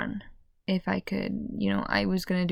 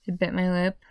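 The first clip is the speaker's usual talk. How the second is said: usual speaking voice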